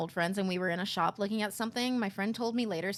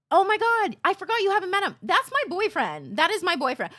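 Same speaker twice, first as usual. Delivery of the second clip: bubbly, higher and louder; excited gush; 8 semitones higher, 6 dB louder than usual. Retold or imitated. Coworker explaining the man is her boyfriend, excited. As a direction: gush with bright surprise. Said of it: "then goes".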